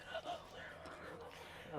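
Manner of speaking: whispered aside, very soft. Real, not acted. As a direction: breathe it under your breath. Muttered after hanging up.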